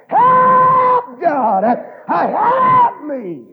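high, loud, drawn-out holler; crying out. Acted. Preacher showing the lost how to cry out, wailing shout. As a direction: holler the plea long and high. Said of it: "all you've gotta do is just cry out".

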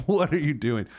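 laughing high disbelief. Real, not acted. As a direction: laugh it out incredulous. Laughing at friend's text.

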